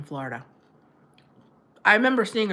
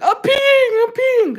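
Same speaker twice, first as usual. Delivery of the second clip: loud, very high comic squeal; mock helpless squeal; 8 dB louder, slower than usual. Imitating herself as the fool in the corner, squealed. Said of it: "the fool in the corner going".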